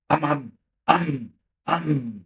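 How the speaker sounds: slow drawn-out boom sounds; drawn-out imitated explosions; artillery booms.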